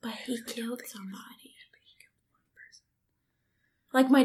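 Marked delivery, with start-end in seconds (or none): 0.0-1.5 hushed whispered aside. Real, not acted. Friend joking about the caught murderer, whispered. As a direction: whisper it like a secret.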